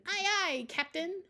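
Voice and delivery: put-on high, loud child's pirate reply; a little girl saluting her captain; Daisy's aye aye.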